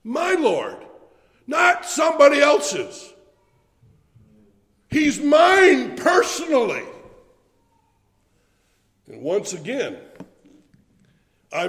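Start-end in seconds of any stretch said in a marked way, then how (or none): 0.1-6.8 high, loud, slow shout on "mine"; fervent preacher shout, stressing "My" and "mine"; preaching shout. Acted. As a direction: proclaim it as yours alone.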